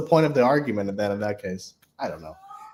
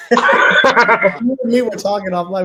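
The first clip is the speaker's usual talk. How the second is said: laughing; 7 semitones higher, 8 dB louder, slower than usual. Real, not acted. Laughing while recalling confusion.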